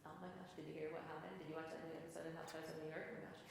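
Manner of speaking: quiet, fast gossipy chatter; soft quick chat with a friend; casual friend chatter. Shown as a demonstration. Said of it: "When I'm talking to my friend, I'm just kinda like".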